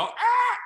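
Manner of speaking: short high-pitched panic scream; loud mock shriek; imitated scream. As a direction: mimic screaming as the car skids. Car skids, both cry out.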